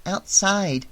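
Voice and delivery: put-on matter-of-fact child's answer; little girl. A narrator's reading. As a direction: answer simply, as a kid. Child answers Annie's question.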